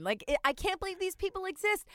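hammed-up disbelief; mock disbelief. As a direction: marvel at the absurdity. Incredulous retold thought.

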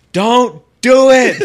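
high, drawn-out panicked yell, played for laughs; coworker yelling. Coworker on the phone begging him not to fight, mock frantic yell. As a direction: imitate a long, loud shout down the phone. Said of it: "my coworker on the phone yelling".

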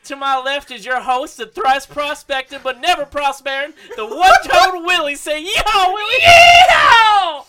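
high hollering country host; yeehaw cowboy voice. Pretend. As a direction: holler it like a rodeo announcer. Hammed-up cowboy podcast intro bit.

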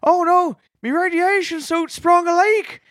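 a Newfoundlander panicking over a leaky suit; Newfoundland accent. Retold or imitated. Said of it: "people from Newfoundland in space".

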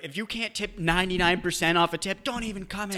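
high, hammed-up indignation; mock indignant server. Co-host imitating food workers online, mock indignant. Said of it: "they think they're like".

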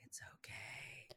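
very soft, slow whisper; hushed, voiceless comfort; whispered reassurance. Shown as a demonstration. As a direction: breathe it out as a soothing whisper. Soothing repeat, hushed.

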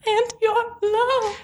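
high, drawn-out swooning delivery; milk it with hammed-up longing; mock lovelorn. Mocking the worst line of the episode, hammed-up lovelorn.

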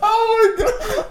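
high laughing, near tears; crying laughing. Real, not acted. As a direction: laugh so hard it hurts.

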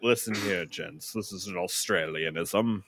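mock-British gent making an announcement; softly spoken, put-on British voice; British accent. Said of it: "That's a good British accent".

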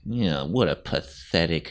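low, quiet sneer; cold teenage contempt. Retold or imitated. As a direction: look down on someone with scorn. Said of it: "You know, like, she's so cold".

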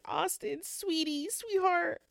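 high, sing-song coaxing, rushing the last line; lightly voiced mom soothing her child; a hint of a pleading mother. Mother coaxing her daughter inside.

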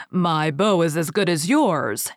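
ordinary delivery

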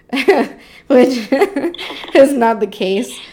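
real laugh breaking in mid-sentence. Real, not acted. Laughing as she tells the podcast listeners about Americans' idea of the Mediterranean.

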